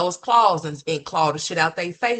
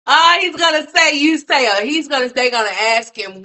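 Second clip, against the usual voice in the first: loud, high excited burst; 10 semitones higher, 9 dB louder than usual. Real, not acted. Excited viewer laughing about the caseworker visit, high and loud. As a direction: blurt it out all wound up. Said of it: "laughing excited buildup".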